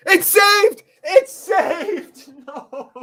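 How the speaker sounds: very high and loud crying yell; real despairing scream half laughing; anguished shout. Real, not acted. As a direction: scream it as the penalty is saved. Penalty saved, fan screams.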